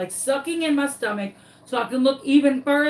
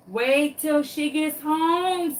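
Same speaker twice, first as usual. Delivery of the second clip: drawn-out, higher mocking warning; slow taunt; 5 semitones higher, slower than usual. Dog taunting the lost chicken, slow mock sing-song. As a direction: stretch it out slowly like a gleeful threat.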